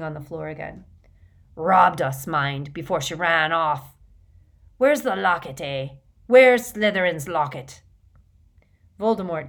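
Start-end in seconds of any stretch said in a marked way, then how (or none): 1.5-7.8 put-on loud, aggrieved drunken lout; rough rustic Morfin. A narrator's reading. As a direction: demand it loudly, working up rage.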